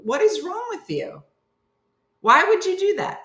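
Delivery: high-pitched incredulous retort; mock outrage. Brother answering an "I love you" call, mock outrage. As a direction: imitate an appalled brother, pitch leaping up.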